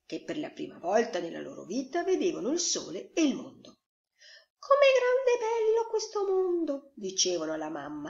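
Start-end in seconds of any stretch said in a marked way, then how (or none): 4.6-6.9 very high little duckling voice; excited ducklings. A narrator's reading. Ducklings tell mum the world is big, excited. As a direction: marvel in a high baby-animal voice. Ducklings marvel to their mother.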